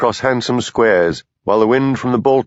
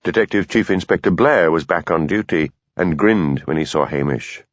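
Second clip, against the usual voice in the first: ordinary delivery, nothing marked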